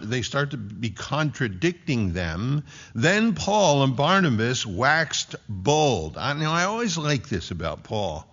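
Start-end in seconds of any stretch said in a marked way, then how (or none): 2.9-6.2 slow, weighty scripture reading; scripture read slowly. Acted. Preacher reading Acts to congregation, slow and solemn. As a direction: lay each word down with weight.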